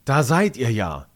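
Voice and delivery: teacher spotting her lost pupils; relieved call. A narrator's reading. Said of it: "ruft sie erleichtert".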